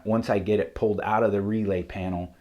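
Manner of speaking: normal talking voice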